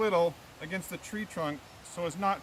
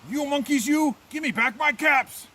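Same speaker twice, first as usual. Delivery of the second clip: angry peddler; 7 semitones higher, 7 dB louder than usual. A narrator's reading. Peddler shaking both hands at monkeys, angry shout. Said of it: "That made the peddler angry".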